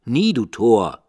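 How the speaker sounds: loud harsh raven reply; raven's retort. A narrator's reading. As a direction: snap back as the raven. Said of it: "Sprach der Rabe".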